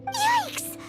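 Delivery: high-pitched squeak of alarm; startled yelp. Acted. Eavesdropper is caught listening in, startled.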